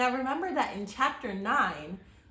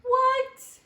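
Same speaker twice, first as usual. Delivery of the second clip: surprised gasp; 13 dB louder than usual. Acted. Reader reacting to the mystery for children, hammed-up gasp. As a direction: gasp then much higher, louder astonished cry.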